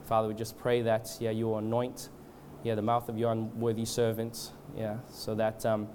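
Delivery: hushed-down, even, halting extempore prayer; leading prayer. Real, not acted. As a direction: pray aloud reverently, feeling for the words. Leads the congregation in prayer.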